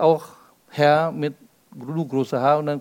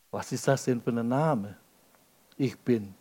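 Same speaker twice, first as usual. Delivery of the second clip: leise, tief nachgeahmter Zweifel; flüstere den skeptischen Einwand der Zuhörer nach; spöttisch zweifelnd; 5 semitones lower, 8 dB quieter than usual. Retold or imitated. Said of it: "ich weiß, was ihr jetzt denkt". (leiser ungläubiger Einwand)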